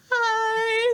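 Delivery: very high, loud chirpy hi; sweet high hi. Retold or imitated. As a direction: chirp it brightly. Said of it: "I was like".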